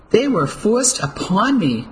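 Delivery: a wronged widow spitting her defence; spat out. A narrator's reading. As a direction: spit each word out in bitter anger. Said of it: "Missus Adams spat out the words".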